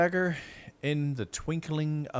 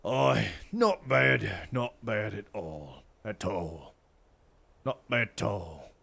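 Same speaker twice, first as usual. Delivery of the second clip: lightly voiced old soldier, slow and approving; a hint of a veteran nodding along; sergeant voice; 2 semitones lower than usual. Sergeant praising the dark elf's archery, mildly gruff.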